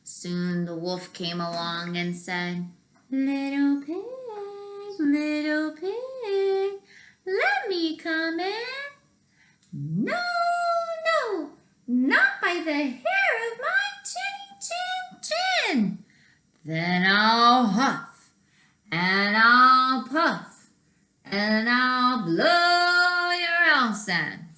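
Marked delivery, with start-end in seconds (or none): 3.1-8.9 high, slow, sing-song wolf call; sly wolf. Acted. Wolf calls at the brick house, high and sing-song. 9.7-16.1 squeaky little pig. Acted. Little pig refuses the wolf, very high and squealing. 16.6-24.5 slow, louder menacing threat; threatening wolf. Acted. Wolf threatens the pig, slow and louder.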